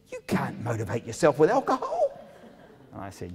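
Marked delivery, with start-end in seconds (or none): 0.1-2.2 high-pitched mock protest; exaggerated, raised-pitch spousal objection; imitated protesting wife. Imitating wife's objection, audience laughs.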